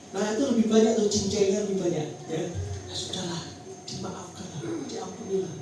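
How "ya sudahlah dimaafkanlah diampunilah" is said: soft, hushed letting-go; gentle forgiving. Acted. Believer letting an offence go, soft and forgiving. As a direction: say it softly, letting it go.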